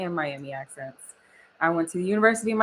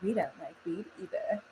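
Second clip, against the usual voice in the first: mock Instagram voice; 11 dB quieter than usual. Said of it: "Instagram's like".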